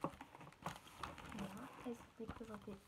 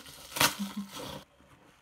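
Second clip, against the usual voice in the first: laughter; 3 semitones lower, 11 dB louder than usual. Real, not acted. Laughing at her bubble popping.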